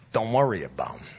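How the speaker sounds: low wiseguy brush-off; mafia tough guy. Retold or imitated. Mobster dismisses worry over rates, low wiseguy voice. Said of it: "like, The Sopranos".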